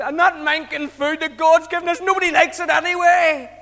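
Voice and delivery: loud, high-pitched whingeing outburst; hammed-up indignant moan; mock complaining. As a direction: complain loudly like a fed-up crowd. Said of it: "they still complain about it".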